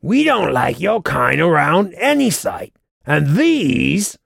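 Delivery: high-pitched goblin guard; hostile, high goblin voice. A narrator's reading. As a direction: sneer it like a goblin turning strangers away. Goblin guard's hostile speech in story.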